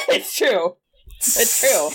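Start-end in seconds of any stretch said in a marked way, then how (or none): 0.1-0.8 laughing agreement. Real, not acted. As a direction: still laughing as she agrees.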